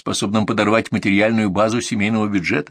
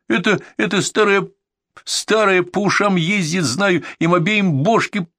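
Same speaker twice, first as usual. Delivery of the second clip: put-on high, grumbling drunk ex-boxer; drunk brawler voice; 11 semitones higher than usual. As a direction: whine like a sullen drunk husband. Drunk husband complaining about wife. (голос пьяного дебошира)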